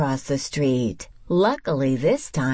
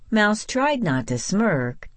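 usual speaking voice

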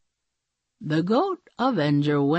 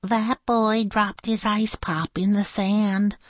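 high-pitched princess voice; high character voice, matter-of-fact remark; Princess Sneezewort; 5 semitones higher than usual. A narrator's reading. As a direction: voice a little storybook princess up high. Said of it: "said Princess Sneezewort".

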